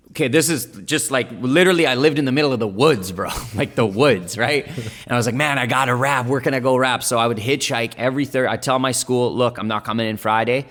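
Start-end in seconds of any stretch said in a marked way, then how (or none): none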